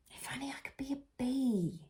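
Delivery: breathy wistful whisper of a bear; sighing wish. Acted. Bear Norman sighing a wish, whispered. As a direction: sigh the wish, soft and unvoiced. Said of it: "he sighed".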